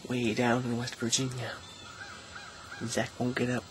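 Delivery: very soft, low-key voice; hushed. Real, not acted. Vlogging beside sleeping Zach on the last morning, hushed.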